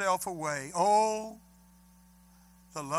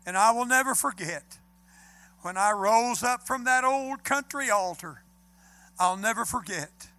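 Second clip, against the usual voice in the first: rising, louder testimony, slowing at the end; fervent preaching; 2 semitones higher, 5 dB louder than usual. Acted. Preacher recalls his conversion to the congregation, fervent and building. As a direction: swell with joy, then linger on sin-sick soul.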